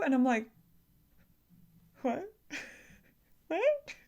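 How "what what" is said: high, quiet, disbelieving squeak; stunned disbelief. Retold or imitated. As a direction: blurt it small and high, as if you misheard. Stunned by the schedule news.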